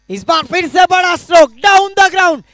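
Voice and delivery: loud, high shout of a four; excited boundary call. Real, not acted. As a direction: bellow it like a stadium commentator. Commentator calls another boundary. (जोरदार चौके की commentary)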